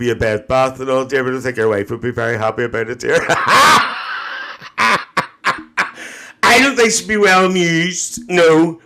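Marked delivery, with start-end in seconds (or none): 3.2-6.1 big laugh. Real, not acted. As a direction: burst out laughing at your own joke. Laughs at own joke.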